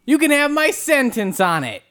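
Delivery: high-pitched showy huckster; rival salesman character. Acted. Sentence Stanley arrives to outbid the word man, rival salesman voice. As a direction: announce yourself as a hammy rival. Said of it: "I'm Sentence Stanley".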